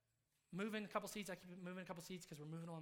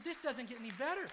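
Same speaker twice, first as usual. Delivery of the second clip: loud, high-pitched exclamation; overjoyed amazement; 7 semitones higher, 11 dB louder than usual. Retold or imitated. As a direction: re-enact being thrilled by news. Retold amazed reaction to news.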